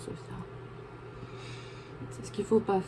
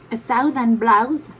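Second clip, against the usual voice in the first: put-on British accent; 3 semitones higher, 8 dB louder, slower than usual. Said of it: "J'adore l'accent british".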